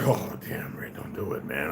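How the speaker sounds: soft, whispered plea to hold back; barely voiced, begging him not to; hushed pleading. Brother whispers to Ray not to fight, hushed. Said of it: "my brother and them like".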